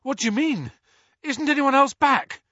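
high, breathless worried questions; panting after the portkey landing; Harry pants in alarm to Mrs Weasley. A narrator's reading. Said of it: "Harry panted".

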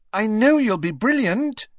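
put-on high, loud girlish encouragement; cheering on. A narrator's reading. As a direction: gush it high and eager. Lavender encouraging Ron.

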